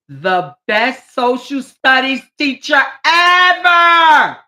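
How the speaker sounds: loud, slow shout of praise; excited shout. Real, not acted. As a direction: cheer him on loudly.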